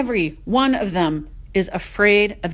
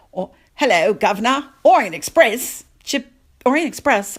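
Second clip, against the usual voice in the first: loud, hammed-up "governor" British accent; big, higher, silly British accent; mock British accent; 4 semitones higher, 6 dB louder than usual. Imitating the border agent, hammed-up British accent. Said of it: "he did it with an accent".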